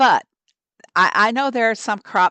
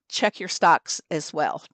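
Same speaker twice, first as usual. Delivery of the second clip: ordinary delivery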